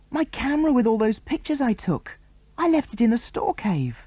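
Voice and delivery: high-pitched wail of dismay; horrified. A narrator's reading. Tom to the others, horrified high wail. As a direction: cry it out in horror. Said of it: "his face a picture of horror".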